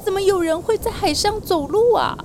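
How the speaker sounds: high startled cry, then a scared question; a fisherman spooked by a figure on the water; frightened. Acted. Disciple sees a figure walking on the sea, frightened. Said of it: "他心里好害怕".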